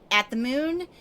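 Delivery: ordinary talk, nothing marked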